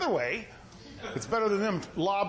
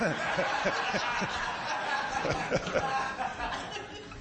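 breathy laugh; 5 semitones higher than usual. Real, not acted. Preacher laughing at the birthday card punchline, breathy chuckle.

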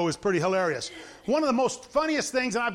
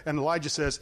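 ordinary talk

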